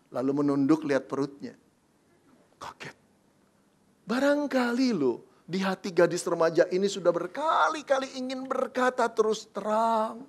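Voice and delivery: ordinary talk, nothing marked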